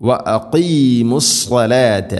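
സ്വലാത്ത slow, opening on a thick, dark s; heavy saad. Shown as a demonstration. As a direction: recite with a full-mouthed s in സ്വലാത്ത. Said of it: "കനപ്പിച്ച് ഉച്ചരിക്കണം".

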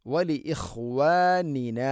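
ഇഖ്വാനിന said slowly and exactly; slow, exact വലി ഇഖ്വാനിന; precise recitation. Shown as a demonstration. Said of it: "കൃത്യമായിട്ട് ഉച്ചരിക്കണം". (ഇഖ്വാനിന കൃത്യമായി ഉച്ചരിക്കുക)

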